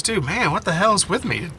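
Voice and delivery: ordinary delivery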